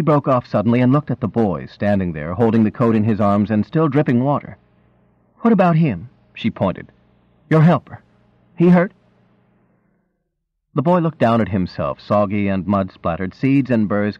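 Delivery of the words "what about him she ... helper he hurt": sharp demanding wife. A narrator's reading. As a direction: demand an answer, worried. Wife demands about boy.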